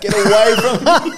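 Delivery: high, loud imitation of a wife recoiling in disgust; mock-shrieking to get away from his breath; wife in labour pushes her husband away, imitated in a high, loud, laughing shout. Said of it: "And she goes".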